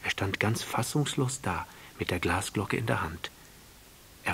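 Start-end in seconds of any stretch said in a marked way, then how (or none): none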